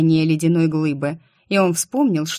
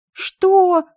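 shrieked "what?", much higher and louder; yell; 14 semitones higher, 6 dB louder than usual. A narrator's reading. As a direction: scream it in outrage. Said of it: "заорала я". (возмущённый крик, намного выше и громче)

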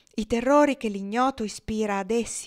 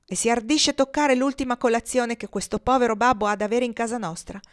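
loud, higher indignant scold; 4 semitones higher, 7 dB louder than usual. Acted. Chloe scolds her son for touching the last breakfast, loud. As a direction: give him a tongue-lashing.

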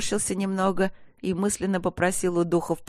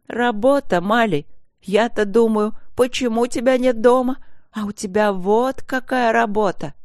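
raised, higher voice, indignant; angry wife; 6 semitones higher, 4 dB louder than usual. A narrator's reading. Wife confronts her husband over his so-called work, angry. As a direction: rail at him with mounting outrage. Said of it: "крикнула жена зло".

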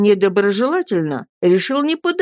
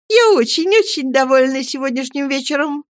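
higher, airy delighted mother; cheerful mother; 8 semitones higher than usual. A narrator's reading. Mother tells Peggotty about her evening, pitched high and delighted. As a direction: gush with pleasure. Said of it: "весело ответила матушка".

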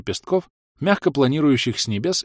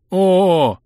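high, louder oh joining in; echoing oh; 9 semitones higher, 7 dB louder than usual. A narrator's reading. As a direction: chime in with a drawn oh. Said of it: "подхватил я".

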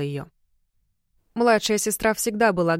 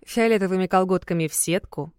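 ordinary delivery

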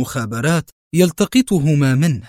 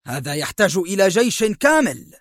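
nervous irritation; 6 semitones higher than usual. Acted. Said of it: "قال مايكل في عصبية".

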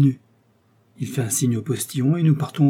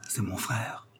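lowered voice; 10 dB quieter, slower than usual. A narrator's reading. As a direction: drop the voice to share a secret. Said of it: "dit Milady en baissant la voix".

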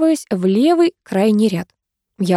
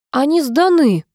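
grudging, pitch lifted high; reluctant higher-pitched confession; reluctant admission; 7 semitones higher than usual. A narrator's reading. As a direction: admit it unwillingly. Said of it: "неохотно признался Фёдор".

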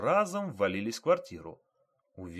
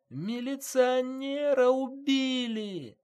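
very high, drawn-out wail of alarm; wailing cry; 5 dB louder, much slower than usual. A narrator's reading. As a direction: cry it out slowly to the whole yard, way up high. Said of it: "заголосила".

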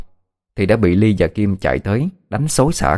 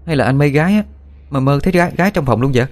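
put-on teasing girl; 6 semitones higher, faster than usual. Ly teases Minh.

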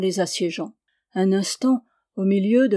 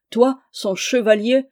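louder, indignant outburst; Aubry's mild furious cry; 3 semitones higher, 6 dB louder than usual. Acted. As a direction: spit it back at him in outrage. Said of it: "s'écria Aubry furieux".

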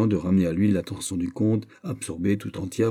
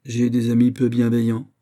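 a touch of wounded anger, louder; mildly piqued; 4 semitones higher, 5 dB louder than usual. A narrator's reading. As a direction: snap back, offended. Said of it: "Il fronça le sourcil". (une pointe de dépit vexé)

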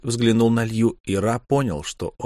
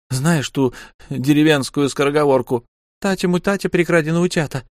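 high, faster, teasing woman's voice; wife mocking her husband in a high teasing voice; Lyu mocking; 6 semitones higher than usual. A narrator's reading. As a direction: needle him with high false indignation.

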